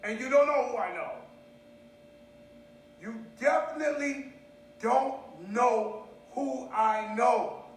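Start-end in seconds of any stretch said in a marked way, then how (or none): 3.0-7.6 slow, spaced word by word; emphatic, drawn out, with gaps; slow emphatic repeat. Real, not acted. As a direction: drive each word home separately.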